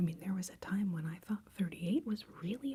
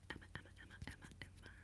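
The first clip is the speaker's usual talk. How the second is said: tiny, repeated whispered name; faint ASMR trigger-word whisper; barely audible whisper; 19 dB quieter, much faster than usual. Pretend. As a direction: whisper the name over and over, very softly.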